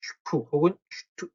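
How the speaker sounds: "슈프" and "슈투" with an opening sh before p and t; teacher sounding out "슈프", "슈투"; sh sound demo. Shown as a demonstration. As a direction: demonstrate "슈프" as shp and "슈투" as sht. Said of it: "어떻게 발음한다 같죠?".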